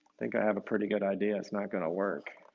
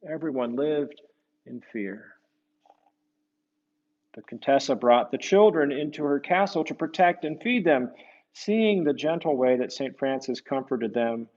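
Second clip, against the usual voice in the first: story narration; 2 semitones higher, 5 dB louder than usual. Acted. Reading aloud to children, lively storyteller. Reads picture book aloud.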